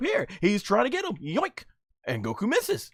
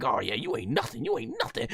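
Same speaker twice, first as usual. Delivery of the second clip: smug taunt; 10 semitones lower, 6 dB quieter than usual. Retold or imitated. Villain mocking Goku with a lightly voiced sneer. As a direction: rub it in, cocky. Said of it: "this is like".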